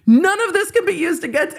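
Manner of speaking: high, sing-song mock of the disclaimer. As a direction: parody it in a squeaky high voice. Mocking the disclaimer.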